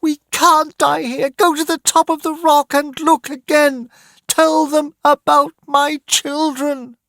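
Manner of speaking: high, urgent orders; high frantic mother's voice; desperate mother commanding. Acted. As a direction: send him up the rock.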